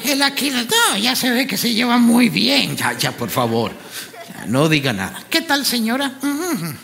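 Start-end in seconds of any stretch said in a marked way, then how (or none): 2.8-5.2 low, different voice begging them to stop; mock pleading. Host to laughing crowd, low mock pleading voice.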